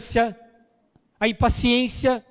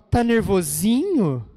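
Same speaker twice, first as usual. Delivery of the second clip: slow mock taunt with a big swoop on nervosinho; imitating wives. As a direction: tease like a needling wife. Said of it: "As esposas falam". (imitação de esposa provocando)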